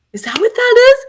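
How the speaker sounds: imitating a high, stunned question; overwhelmed disbelief. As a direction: voice leaping up as if about to sob. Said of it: "she just started sobbing".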